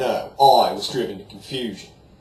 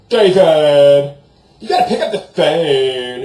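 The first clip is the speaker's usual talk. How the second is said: loud, high hollered call of his name; mom hollering; 4 semitones higher, 10 dB louder, slower than usual. Retold or imitated. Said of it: "hollers and says".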